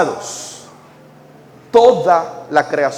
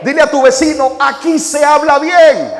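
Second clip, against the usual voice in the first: louder, higher, fiery pulpit delivery; full-force preaching; 9 semitones higher, 4 dB louder than usual. Acted.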